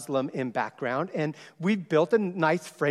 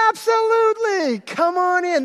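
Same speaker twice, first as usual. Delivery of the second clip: much higher and louder, bursting with joy; delighted welcome; 7 dB louder than usual. Retold or imitated. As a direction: throw the door wide open with your voice. Welcoming the neighbor girls.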